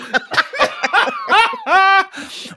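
loud high burst of laughter; real high giggle. Real, not acted. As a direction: cackle with delight. Laughing about clap thing.

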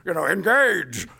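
ringing command to engage; young Picard. Retold or imitated. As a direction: give the order like a starship captain. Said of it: "Do you want young".

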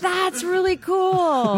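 high, drawn-out "really cool"; stretched high praise that sounds doubtful; upspeak. Real, not acted. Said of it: "when your voice goes up, that upspeak".

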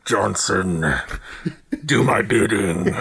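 commanding evil boss; villain boss voice. Pretend. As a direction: order your minion about menacingly. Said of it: "I would just be like".

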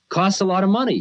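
put-on an old guru hinting he wants cash; guru's teasing voice. Guru teasing the rich American about money, high and playful. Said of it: "he says".

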